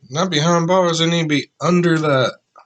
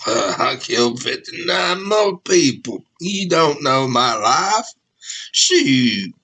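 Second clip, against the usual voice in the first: hillbilly voice; slower than usual. Retold or imitated. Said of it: "I went Hilljack for some reason".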